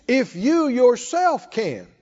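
slow, leaning on "you" and "yourself"; slow deliberate emphasis on "you"; stress on yourself. Shown as a demonstration. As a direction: draw out "if you yourself can", pressing "you". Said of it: "there's an emphasis on the word".

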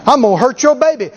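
normal talking voice

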